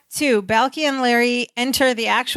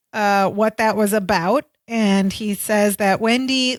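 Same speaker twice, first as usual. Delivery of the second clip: ordinary talk, nothing marked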